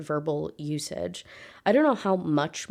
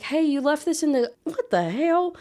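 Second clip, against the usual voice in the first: high-pitched mock surprise; imitating a friend caught off guard; taken aback; 9 semitones higher than usual. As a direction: start breezy, break off in startled disbelief. Friend walks in on a shock.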